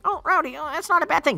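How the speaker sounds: high, whiny mock listener voice; squeaky imitation of a naive friend. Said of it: "and you're probably thinking".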